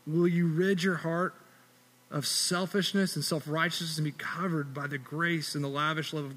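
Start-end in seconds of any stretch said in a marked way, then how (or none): none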